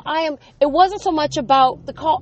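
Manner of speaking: normal talking voice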